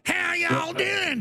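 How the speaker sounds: slow, high, shouted Southern "How y'all doing?"; mock Southern accent. Mocking Hillary greeting Kentucky folks in a fake Southern drawl. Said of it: "I wouldn't put on a Southern drawl".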